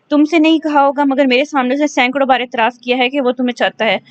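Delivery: ordinary delivery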